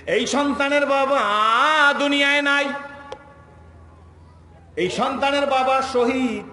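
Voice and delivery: high, loud proclamation; impassioned preaching shout. Real, not acted. Preacher proclaiming the child's father a martyr, high shout. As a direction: cry it out to the whole gathering.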